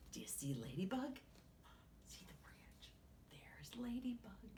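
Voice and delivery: put-on hushed whispered aside to the kids; storyteller whisper. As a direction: whisper as if not to wake anyone.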